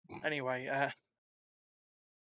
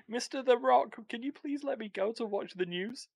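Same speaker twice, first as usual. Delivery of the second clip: mock pleading; 7 semitones higher than usual. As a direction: plead with a laugh. Begging The Rock jokingly.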